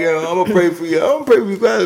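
high, fake sympathetic voice; mock a preachy well-wisher; mocking pious voice. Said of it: "people be like".

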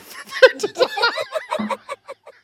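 high, helpless real laughter; cracking up. Real, not acted. Comedian cracking up at own prank call voice, high helpless laughing. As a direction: burst out laughing at your own bit. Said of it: "Why do I find that so funny?".

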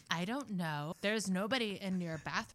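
soft, offhand mock socialite; Kardashian parody. Parody sister denying it, soft put-on socialite voice. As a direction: lightly imitate a reality star, quietly. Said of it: "And she's like".

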